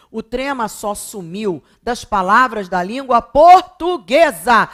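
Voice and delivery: loud, high, hammering each point; exasperated insistence. Real, not acted. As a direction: drive it home to careless students, syllables punched out. Emphatic plea after "pelo amor de Deus.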